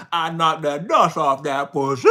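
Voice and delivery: loud, slow, hammed-up movie line; slang movie quote. Mocking how this person would never talk, loud slangy impression. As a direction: belt out the crude line, dragging it out. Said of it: "They don't have, like, a slang".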